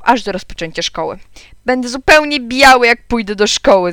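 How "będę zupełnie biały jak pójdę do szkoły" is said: put-on loud, higher boyish protest; calling out. A narrator's reading. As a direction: cry it out in protest. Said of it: "zawołałem". (głośno zawołane, wyżej)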